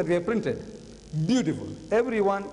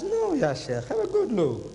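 insistent urging; 8 semitones higher, slower than usual. Retold or imitated. Urging the sheikh to look again.

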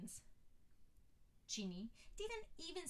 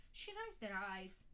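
ordinary delivery

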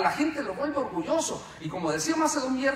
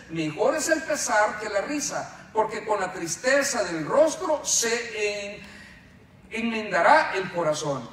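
slow, louder reading of the verse; scripture reading; 6 dB louder, slower than usual. Acted. Preacher reads Ecclesiastes to the congregation, slow and solemn. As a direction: read the verse out slowly for the whole church.

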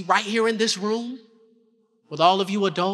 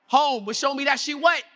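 ordinary delivery

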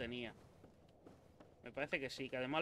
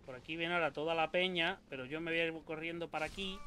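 ordinary delivery

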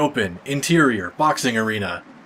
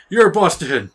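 loud, raised arrest call; mock cop shout; 5 semitones higher, 6 dB louder than usual. Player cop collaring a crook, mock cop shout. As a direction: call out the bust like a hammy cop. Playing the cop making a bust.

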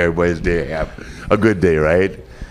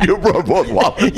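higher, laughing through the words; amused disbelief, cracking up; giggling repeat; 10 semitones higher than usual. Real, not acted. Laughing at the wallets joke.

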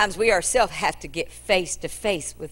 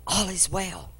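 quiet reply; 6 dB quieter, slower than usual. Acted. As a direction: murmur it without looking at him.

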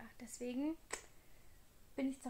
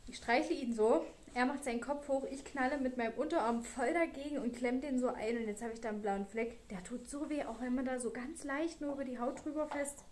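ordinary talk, nothing marked

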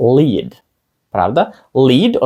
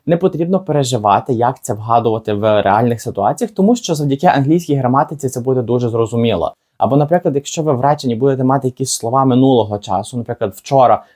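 normal talking voice

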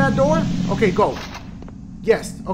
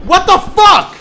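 loud, high burst of alarm; startled yell; 10 semitones higher, 9 dB louder, slower than usual. Real, not acted. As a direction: yell it as if caught off guard. Startled in the game.

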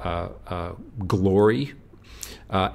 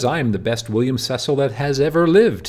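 boastful; 5 semitones higher, 5 dB louder than usual. Retold or imitated. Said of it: "So here he is boasting".

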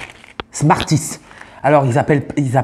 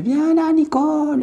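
calling sweetly; 13 semitones higher, slower than usual. Acted. Calling Nicole, high put-on voice.

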